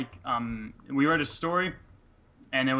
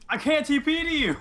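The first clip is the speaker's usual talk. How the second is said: much higher, louder, distressed; high distressed cry; 14 semitones higher, 4 dB louder than usual. Real, not acted. Player failing to reach his friend, high and distressed. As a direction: wail in frustration.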